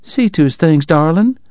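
wife directs her husband to fetch bags, sweetly bossy; bossy Southern lady. Acted. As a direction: order her husband about with a smile. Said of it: "she ordered".

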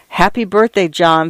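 ordinary delivery, nothing marked

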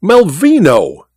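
high, drawn-out exclamation of the name; astonished cry. A narrator's reading. Goodall to the blandly waiting group, crying out. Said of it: "cried he".

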